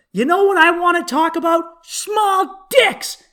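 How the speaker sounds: high, loud heckling yell; mock yell. Drunk wife yelling at the comic, a mocking high shout. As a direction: shout it across the room at the comic. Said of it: "yells to James".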